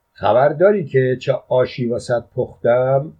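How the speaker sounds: plain reading voice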